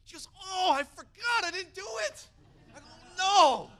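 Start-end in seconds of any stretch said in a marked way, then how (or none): 0.4-2.3 hammed-up wail of regret, high and loud; dismayed realization. Wife realizes she forgot, acted. 3.2-3.7 hammed-up loud, high "no"; dismayed cry. Acted reaction to missed chance.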